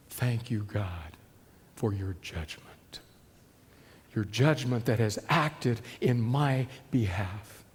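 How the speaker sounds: hushed tearful prayer of thanks; weeping thanks. Retold or imitated. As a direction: thank God through quiet weeping. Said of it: "that pastor weeps".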